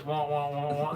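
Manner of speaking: low, soft wah-wah babble; Charlie Brown adults. Retold or imitated. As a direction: imitate muffled cartoon grown-up gibberish. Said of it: "You know the Charlie Brown".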